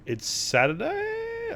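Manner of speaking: slow, rising shock at the date; surprised. Real, not acted. Player notices the game's day is Saturday, surprised. In-game calendar shows Saturday.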